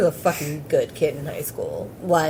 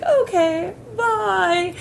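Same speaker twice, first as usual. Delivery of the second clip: much higher, airy okay bye; stoned sing-song goodbye; much slower than usual. Retold or imitated. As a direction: float it up like you're baked.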